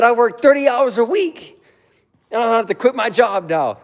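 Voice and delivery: high, loud, put-on complaining voice; mocking whiny boyfriend. Mocking the boyfriend whining about his job, high and loud.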